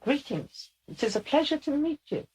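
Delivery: high, squeaky formal greeting; Leica. Acted. Channeled lieutenant meets a new sitter, high and squeaky. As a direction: greet politely in a high-pitched character voice. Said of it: "somebody that has a very squeaky voice".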